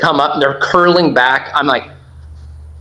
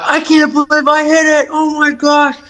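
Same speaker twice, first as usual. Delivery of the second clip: high, thrilled outburst; retold joy at the lucky shot; much higher, giddy voice; excited disbelief; 12 semitones higher than usual. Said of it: "And I'm like".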